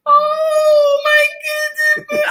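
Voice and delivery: very high, slow, drawn-out oh my goodness; stunned gasp. Retold or imitated. Lab staff coming out after the test, stunned and drawn out. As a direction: stretch it high in shock.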